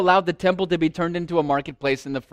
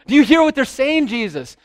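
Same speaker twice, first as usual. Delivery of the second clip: higher and louder, pressing him; pressing challenge; 7 semitones higher, 5 dB louder than usual. Retold or imitated. Priests pressing Jesus to answer, louder challenge. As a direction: push the question harder after a silence. Priests repeat after long pause.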